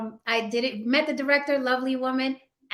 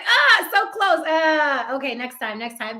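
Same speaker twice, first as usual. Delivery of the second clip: higher, louder and slowed, so close it hurts; exasperated sigh; 4 semitones higher, 5 dB louder than usual. Retold or imitated. Recalls near miss on role.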